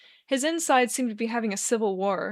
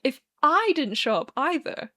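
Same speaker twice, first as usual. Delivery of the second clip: slow, higher, playful hint; coy; 5 semitones higher than usual. A narrator's reading. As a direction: tease it out coyly. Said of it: "Arthur says coyly".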